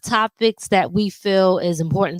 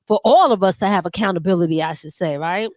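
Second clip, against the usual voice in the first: ordinary delivery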